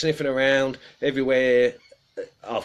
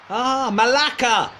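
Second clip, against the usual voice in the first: Malaka drawn out slowly, higher and louder; drawn-out Greek swear; 12 semitones higher, 7 dB louder, slower than usual. Pretend. Reading out a Greek swear word to the chat, drawn out. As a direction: savour the Greek curse slowly. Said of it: "the only word I know in Greek".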